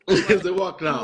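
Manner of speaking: laughing echo of the answer. Real, not acted. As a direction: repeat it through a laugh. Host laughs repeating guest.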